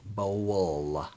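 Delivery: "bowl" with a long, rounded "oh"; overpronounced bowl. Shown as a demonstration. Streamer showing how it is pronounced, stretched "bowl". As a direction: draw "bowl" right out. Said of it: "That's how we pronounce it".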